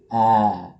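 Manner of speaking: wide open A vowel, stressed; showing the mouth shape; model A sound. Shown as a demonstration. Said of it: "posisi mulut tuh kayak gini".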